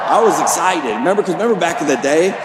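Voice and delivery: normal talking voice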